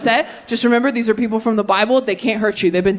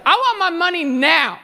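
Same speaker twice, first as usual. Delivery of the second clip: bratty son; 6 semitones higher than usual. Retold or imitated. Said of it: "the younger son is like".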